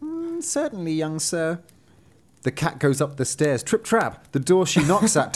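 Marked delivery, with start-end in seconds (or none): 0.0-1.6 a demure cat curtseying to a suitor; coy high cat maid. Acted. Cat maid answers fox.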